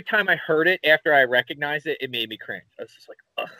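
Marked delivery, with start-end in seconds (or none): none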